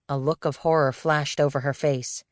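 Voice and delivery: usual speaking voice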